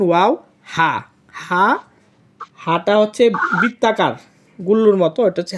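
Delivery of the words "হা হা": ordinary talk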